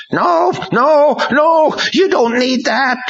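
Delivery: demons crying out. A narrator's reading. Demons crying into Ron's brain, shrieking. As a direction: cry out as a chorus of demons. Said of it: "They all cried into Ron's brain".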